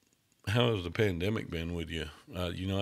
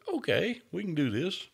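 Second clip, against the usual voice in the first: high clown voice; 9 semitones higher than usual. Retold or imitated. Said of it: "So I said".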